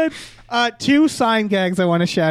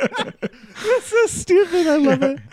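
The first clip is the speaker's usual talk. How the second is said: higher, slow, laughing delight; 11 semitones higher than usual. Real, not acted. Laughing about the diaper gag, high and slow. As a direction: giggle it out.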